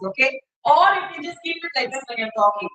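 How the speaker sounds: ordinary talk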